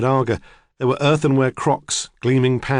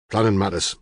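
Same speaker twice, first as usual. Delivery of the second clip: short, clipped answer, a little lower; curt; 4 semitones lower than usual. Acted. Farmer brushing off the detective's question, curt. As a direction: cut it short, unwilling to say more. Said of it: "Richardson said shortly".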